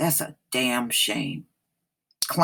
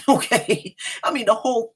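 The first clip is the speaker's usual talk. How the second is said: high-pitched laughing okay; amused disbelief; 9 semitones higher, faster than usual. Real, not acted. Commenting to listeners on a story she doubts, laughing. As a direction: laugh it out, much higher. Scoffing at the story just read.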